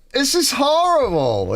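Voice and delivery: high, slow whiny voice of a struggling newbie; struggling beginner. Retold or imitated. Playing a newbie guitarist struggling on the Bronx corner, high whine. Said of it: "I'm like".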